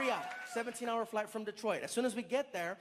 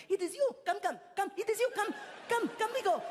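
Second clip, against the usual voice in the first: put-on excited Arabic accent; 13 semitones higher than usual. Sign holder urges the comedian along in a high excited Arabic accent. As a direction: hurry him along, thrilled to see him. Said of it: "he's really excited".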